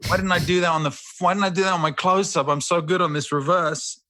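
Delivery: high, quick actor's lament; mock self-reproach. Actor regretting his close-up, mock-anguished whine.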